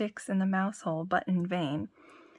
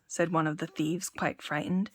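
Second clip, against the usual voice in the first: ordinary delivery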